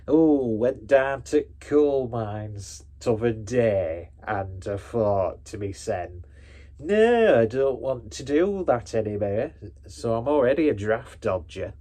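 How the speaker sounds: loud, drawn-out stage northern; hammed-up northern English miner; generic northern accent. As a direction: play a fifties kitchen-sink drama northerner. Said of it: "a generic northern accent from a fifties kitchen sink drama".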